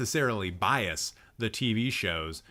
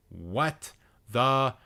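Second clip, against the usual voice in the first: very slow, word by word, loud finish; exasperated outburst; 6 dB louder, much slower than usual. Real, not acted. Streamer ending tirade over Vertigo, slow loud exasperation. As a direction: drag out each word in exasperation. Capping the list of outrages.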